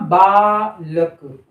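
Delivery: "बालक" said very slowly; slow demo word. Shown as a demonstration. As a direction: drag "बालक" out. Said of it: "बोल कर देखिए". ("बालक" को धीरे-धीरे बोलना)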